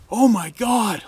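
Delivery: loud, high cry of alarm; horrified wrangler. Retold or imitated. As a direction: exclaim in shock at an accident. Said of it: "The wrangler's like".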